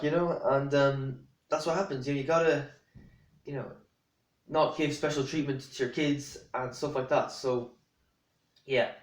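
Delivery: ordinary talk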